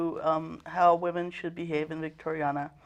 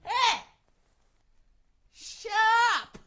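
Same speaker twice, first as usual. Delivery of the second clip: shouting; 13 semitones higher, slower than usual. Acted. Mother silences fighting children.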